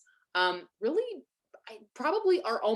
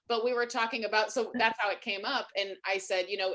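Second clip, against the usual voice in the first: ordinary talk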